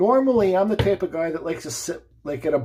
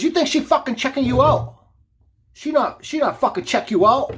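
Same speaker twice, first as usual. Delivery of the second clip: put-on high, accented broken-English yelling; retold angry yell; 8 semitones higher, 4 dB louder than usual. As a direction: mimic the man shouting his accusation. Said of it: "he looks at me and he yells".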